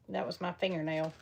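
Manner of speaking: ordinary talk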